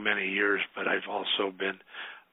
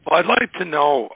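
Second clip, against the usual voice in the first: hoarse voice from a bad cold; 7 semitones higher, 6 dB louder than usual. Real, not acted. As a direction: speak through a hoarse, sick throat. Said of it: "excuse my voice".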